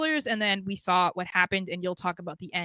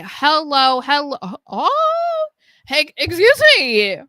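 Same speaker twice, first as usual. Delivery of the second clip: calling out; 6 semitones higher, 7 dB louder, slower than usual. Acted. Calling out to chat joiner.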